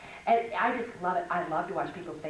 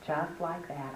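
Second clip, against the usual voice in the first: ordinary talk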